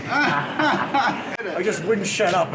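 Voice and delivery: laughing through the line. Real, not acted. Comic laughing at his own joke. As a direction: cracks up mid-sentence.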